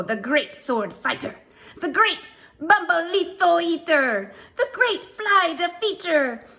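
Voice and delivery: high, loud mock-heroic proclamation; grand boasting. A narrator's reading. Scapito introducing himself at the shack, boasting grandly. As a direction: announce each title with mounting bravado.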